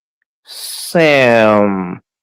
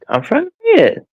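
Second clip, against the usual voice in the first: exasperated. Real, not acted. Friends interrupting his reading.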